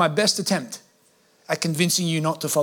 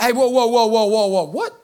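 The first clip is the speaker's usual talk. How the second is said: alarmed protest; 4 semitones higher, 3 dB louder than usual. Acted. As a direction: act out someone halting everything in disbelief. Congregation's alarmed reaction to the bill.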